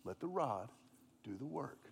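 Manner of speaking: fading low self-reminder; remembered mantra. Retold or imitated. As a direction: repeat it to yourself, dying away. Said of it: "And I remember".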